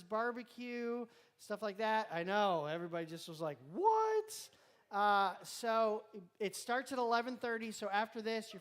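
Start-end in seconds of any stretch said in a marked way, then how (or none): 3.7-4.5 high surprised "What?". Retold or imitated. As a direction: yelp it in disbelief. Quoting everybody's reaction.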